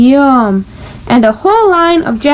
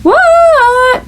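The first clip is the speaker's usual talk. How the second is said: high, drawn-out answer; called reply; 10 semitones higher than usual. A narrator's reading. Froggy answers his mother's call, drawn out. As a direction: shout back from the bedroom.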